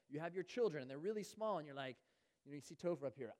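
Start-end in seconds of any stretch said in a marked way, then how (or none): none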